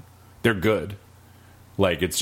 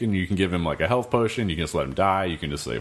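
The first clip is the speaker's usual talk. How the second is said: ordinary talk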